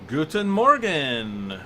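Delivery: slow, loud, hammed-up German accent on "Guten Morgen"; bad German accent. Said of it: "the worst possible German accent".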